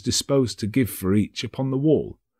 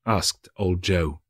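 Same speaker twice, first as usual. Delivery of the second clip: ordinary delivery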